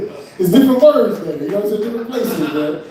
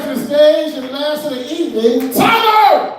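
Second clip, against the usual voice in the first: host announcing; 7 semitones higher than usual. Real, not acted. Host calling the last comic to the stage, high announcer yell. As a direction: bring the comic up with a big call.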